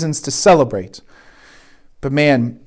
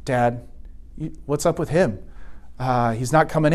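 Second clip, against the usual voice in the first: lower, softer younger brother; lightly voiced son, quietly asking about his brother; 4 semitones lower, 10 dB quieter than usual. Younger son asks dad about the older brother, lower and softer. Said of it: "the younger brother's like".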